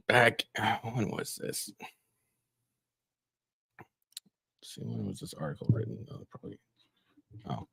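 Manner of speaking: very soft, half-whispered; distracted murmur while looking something up; muttering to self. Real, not acted. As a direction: think aloud under your breath. Searching for article date to himself.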